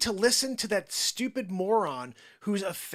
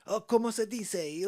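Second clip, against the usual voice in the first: fake Spanish accent; 5 semitones higher than usual. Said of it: "I was like".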